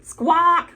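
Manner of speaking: high, loud grinning squawk; crow squawk. A narrator's reading. Crow squawking with a grin at the buffalo. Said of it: "said the crow with a grin".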